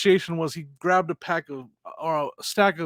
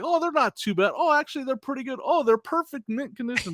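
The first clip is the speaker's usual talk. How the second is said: put-on higher-pitched kid, revising step by step; imitated kid haggler; 6 semitones higher than usual. Seller imitating the young negotiator walking up, haggling kid. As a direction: discover the goods improving as you approach. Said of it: "As he was walking towards me".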